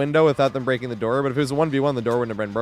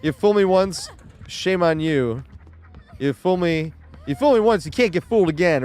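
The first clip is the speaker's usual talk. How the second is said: lightly voiced Bush gaffe, pitched up; imitating George Bush; 5 semitones higher than usual. Streamer quoting a Bush gaffe, pitched up and mocking. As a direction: mangle the saying like Bush, higher. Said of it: "what did George Bush say".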